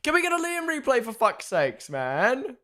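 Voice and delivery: high-pitched frustrated demand; real exasperated outburst. Real, not acted. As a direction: complain with the pitch jumping up, fed up. Exasperated at missing replay.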